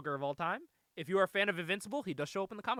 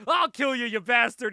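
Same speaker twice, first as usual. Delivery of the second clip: loud, raised mock-furious threat; badass threat; 4 semitones higher, 12 dB louder than usual. Hero acting badass once back outside, loud mock threat. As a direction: blurt a big tough-guy threat. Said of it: "He's acting all badass again".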